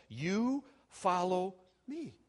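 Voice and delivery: YOU, FOLLOW and ME each set apart slowly; slow, emphatic "You follow me", word by word; emphatic command. Acted. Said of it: "very emphatically, Christ says".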